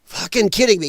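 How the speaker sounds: loud real outrage at the headline; incredulous shout. Retold or imitated. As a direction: blast it out in disbelief. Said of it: "I was like".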